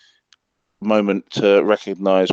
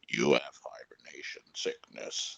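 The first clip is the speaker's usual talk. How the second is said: breathy whispered line; 8 semitones lower, 14 dB quieter than usual. Retold or imitated. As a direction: whisper it like a secret. Quoting a line from the film.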